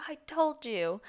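high-pitched mock yell at normal volume; mock shout. Podcaster imitates neighbor shouting to the whole neighborhood, mock shout. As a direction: imitate someone calling out to the whole street, high. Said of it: "shouting to the whole neighborhood".

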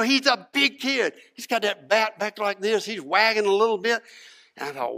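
ordinary talk, nothing marked

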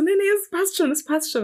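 high-pitched, repeated brush-off; acted nervous insistence that all is fine; hasty reassurance. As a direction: imitate a flustered wave-away. Retells brushing off his concern.

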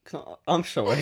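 giggling through a yawn; laughing apology. Real, not acted. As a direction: laugh it out sheepishly. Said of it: "Can I have a yawn".

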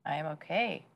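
soft echo of the affirmation, barely voiced above a murmur; quiet repeat. Real, not acted. Host softly echoing the guest's affirmation, quiet. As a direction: repeat it back softly like a follower. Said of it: "And repeat after me".

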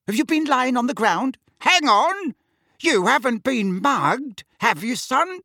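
high, anxious fussing mother; frantic mother fussing over her son; Aunt Petunia. Acted. Mother fretting over her sick son, high and anxious.

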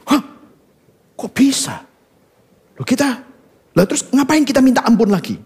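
high, stunned disbelief; shocked listener. Retold or imitated. Confused congregant reacts to pastor, high shocked disbelief. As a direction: gasp it out, then protest.

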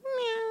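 very high little meow; a child's tiny cat sound; toddler's meow. Retold or imitated. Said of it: "And she goes".

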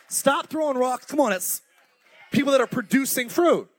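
loud, fired-up preaching; calling the church out loud and fired up. Real, not acted. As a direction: let it burst out, full of fire. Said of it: "Sorry, get fired up".

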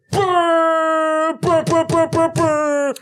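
put-on high, loud, buzzing brr sounds like a kid bashing the keys; loud, high mock piano racket; kid's piano noise. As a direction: imitate a child banging piano keys. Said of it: "this kid would come over and just go like".

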